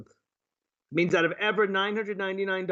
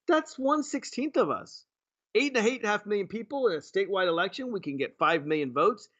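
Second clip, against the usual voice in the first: ordinary talk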